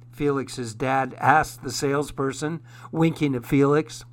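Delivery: usual speaking voice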